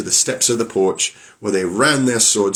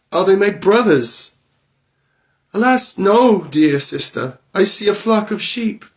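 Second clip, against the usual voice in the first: anxious sisters; 11 semitones higher, 3 dB louder than usual. Acted. Wife and sister exchange, high voices.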